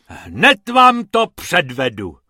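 lightly voiced hippo, loud and pompous; a big animal introducing himself grandly.

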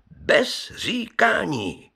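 slow, high stress on "říkání", falling at the end; emphatic repeat. Acted. Brewer drives his point home to the gentlemen, emphatic repeat with "říkání" stretched high.

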